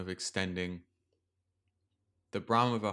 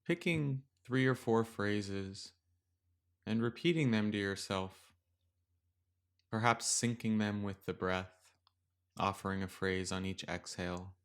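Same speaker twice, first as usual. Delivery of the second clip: gentle metta meditation read; guided meditation. Acted. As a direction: ease the listener into stillness with long pauses.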